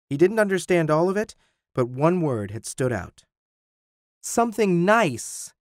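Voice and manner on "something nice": slow, high, drawn-out complaint; peeved, drawn-out whine; complaining. A narrator's reading. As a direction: complain with pitch raised and words stretched. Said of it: "he complained".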